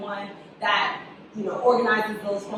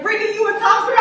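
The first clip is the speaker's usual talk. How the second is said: excited announcement; 11 semitones higher, 8 dB louder than usual. Retold or imitated. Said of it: "They're like".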